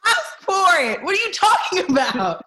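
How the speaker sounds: high, loud indignant outburst; loud protest. Real, not acted. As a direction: protest at the top of your voice. Protests being accused of asking.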